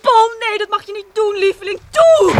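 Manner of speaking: high, loud frantic begging; desperate pleading. Acted. Wife begs Paul not to dive in, desperate. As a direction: beg him to stay, rising to a cry.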